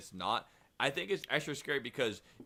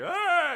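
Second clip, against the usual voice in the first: high, loud startled shriek; retold scream; 6 dB louder than usual. Kid grabs stranger's jacket, screams in fright.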